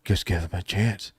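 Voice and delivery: low, soft, inflectionless monotone; mock deadpan hero, low and quiet; Mandalorian. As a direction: drop it low and strip out all inflection. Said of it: "not gonna use any inflection in my voice".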